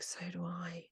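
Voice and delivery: a secret little confession; whispered. Retold or imitated. Agreeing with Joe about the third thing, whispered.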